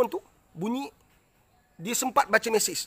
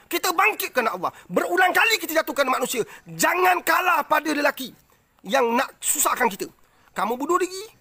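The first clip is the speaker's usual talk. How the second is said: fervent preaching; 4 semitones higher than usual. Real, not acted. Preaching to a suicidal viewer.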